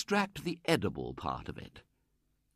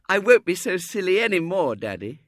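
put-on high, louder childish vow; little girl; 9 semitones higher, 8 dB louder than usual. A narrator's reading. Little girl to daddy, high childish promise.

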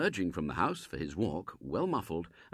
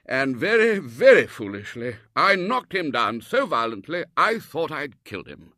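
high and loud, emphatic confession; confessing Colonel; 7 semitones higher, 8 dB louder than usual. Acted. Colonel confessing he knocked Robert down, loud and emphatic. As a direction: own up with bluster.

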